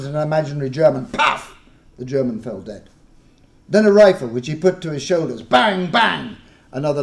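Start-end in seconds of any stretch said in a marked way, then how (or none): 1.1-1.6 pistol puff. Acted. Imaginary pistol shot. 5.4-6.4 loud, high bang bang of a toy rifle; mock gunshots. Imaginary rifle fire.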